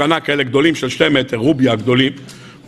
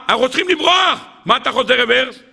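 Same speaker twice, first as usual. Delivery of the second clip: panicked shout; 7 semitones higher than usual. Acted. Israelites panic retreating.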